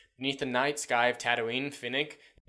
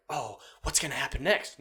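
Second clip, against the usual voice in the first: raised, eager wondering; mock suspense; 3 semitones higher than usual. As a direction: wonder aloud as if hooked. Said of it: "but, like, unpredictable, like".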